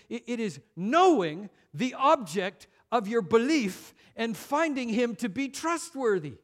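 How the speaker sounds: high, loud, driving sermon voice; fervent preaching. Acted. Preacher urging the congregation, high and loud. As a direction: press the point home to the congregation.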